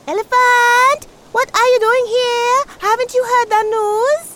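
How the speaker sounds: high, loud calling out to Elephant. A narrator's reading. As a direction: shout it across to the elephant. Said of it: "In a loud voice, Tortoise called out".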